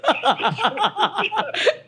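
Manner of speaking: a loud, high, rising burst of laughter; helpless laugh. Real, not acted. Dad cracking up while retelling the phone call, loud laugh. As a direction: lose it completely laughing.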